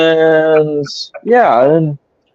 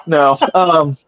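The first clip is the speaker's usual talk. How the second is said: normal talking voice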